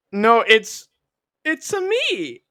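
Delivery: high, desperate cartoon plea; protest, rising into the plumber voice; pleading Mario catchphrase. Rejected actor pleads as Mario.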